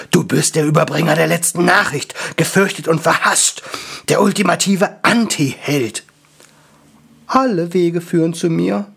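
solemn high angel. Acted. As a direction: intone it like a prophecy.

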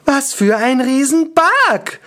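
high, drawn-out wail; slow, high-pitched lament; wailing elf. A narrator's reading. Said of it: "heulte Anker".

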